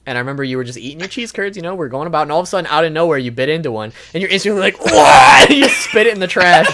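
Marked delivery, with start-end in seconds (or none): 4.8-5.4 loud mock yelp of someone spitting out food; hammed-up startled yelp, much louder; imitated yelp of disgust. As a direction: blurt a big disgusted wah, as if the food is awful. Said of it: "that's very accurate representation of how he sounded".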